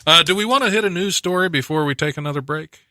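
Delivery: ordinary talk, nothing marked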